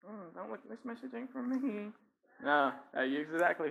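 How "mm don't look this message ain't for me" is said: imitated inner thought. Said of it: "some of you here this morning thinking".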